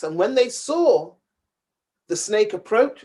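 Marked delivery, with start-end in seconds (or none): none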